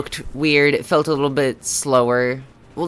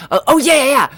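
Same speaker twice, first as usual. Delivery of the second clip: excited; 10 semitones higher, 6 dB louder, faster than usual. Real, not acted. Gets excited at the game.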